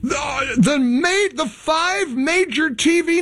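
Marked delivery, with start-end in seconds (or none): 0.0-0.5 mock sob. Mock sob at networks' boycott.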